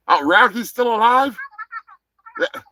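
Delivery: ordinary delivery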